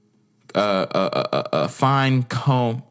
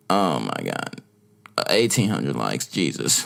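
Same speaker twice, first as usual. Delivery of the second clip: much lower, deflated disbelief; low dismay; 10 semitones lower than usual. Real, not acted. Vlogger seeing eighteen hundred likes, low dismayed aside. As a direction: sink right down in pitch, appalled.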